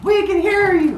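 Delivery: very high, sing-out call; high-pitched distant townsfolk reply; crowd calling back. Acted. Far-off crowd calls back to Aesop.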